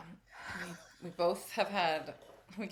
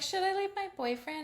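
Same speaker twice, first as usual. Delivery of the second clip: high whiny client; 13 semitones higher than usual. Retold or imitated. Client quoted.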